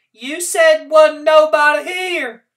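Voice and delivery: slow, high, loud accusation; accusing. Acted. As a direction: accuse her, drawn out. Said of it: "she accused Gilly".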